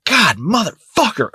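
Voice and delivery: high, slow pained curse; dismayed curse. Retold or imitated. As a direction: groan it out in disbelief. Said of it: "I was like".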